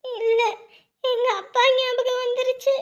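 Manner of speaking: slow, tearful words through sobs; crying for her father. Acted. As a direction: sob out the homesickness. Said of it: "அப்புறம் எதுக்கு அழுதுட்டு இருக்க".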